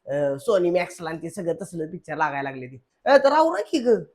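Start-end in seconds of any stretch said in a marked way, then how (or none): 3.1-4.0 raised, higher call to hurry her; husband calling his wife to hurry up the game, raised voice. Real, not acted.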